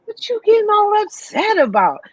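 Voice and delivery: high-pitched imitation of a child; little boy reassuring. Little Caleb to upset mama, imitated high child voice. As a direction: play the small son questioning mama, pitched well up. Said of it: "little Caleb comes and puts his hand".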